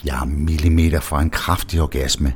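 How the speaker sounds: ordinary delivery, nothing marked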